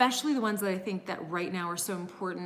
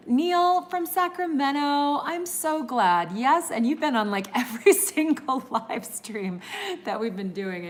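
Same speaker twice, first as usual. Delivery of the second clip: real gushing excitement, pitched up and loud; delighted greeting; 7 semitones higher, 6 dB louder than usual. Real, not acted. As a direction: call out gladly to a favorite regular. Delighted greeting of a regular viewer.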